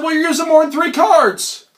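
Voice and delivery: high, loud comic objection; indignant protest. Retold or imitated. Visitor accusing the hustler, loud and indignant. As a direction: object in rising exasperation.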